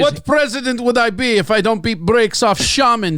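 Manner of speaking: put-on comic Russian accent, indignant boast; Putin in Russian accent. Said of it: "you can do a Russian accent".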